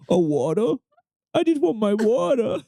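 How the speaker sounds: put-on high babyish plea for water; mock whiny voice. Teasing his co-host, a high whiny baby voice asking for water. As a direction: whine it like a thirsty toddler. Mocks pronunciation, pitch jumps high.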